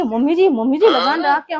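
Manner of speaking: normal talking voice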